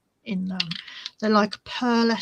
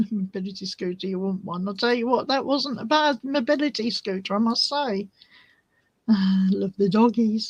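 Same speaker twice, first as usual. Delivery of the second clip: ordinary talk, nothing marked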